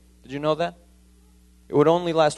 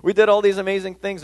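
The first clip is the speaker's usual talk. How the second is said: excited disciples; 6 semitones higher, 5 dB louder than usual. Retold or imitated. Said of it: "they're like".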